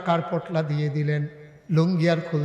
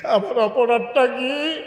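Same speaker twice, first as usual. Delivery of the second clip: raised, slow, drawn-out plea; anguished protest; 7 semitones higher, 5 dB louder, slower than usual. Acted. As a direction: cry out the question in hurt. Abdur Rahman's anguished protest.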